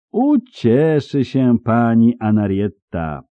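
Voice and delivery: lingering pompous declaration; drawn-out proclamation. Acted. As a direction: announce it with stretched words. Baron proclaiming loudly, drawn out.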